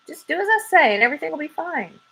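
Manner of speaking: quick high coaxing; brisk assurance. Acted. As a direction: rush to calm him down. Dirt reassures panicking squirrel.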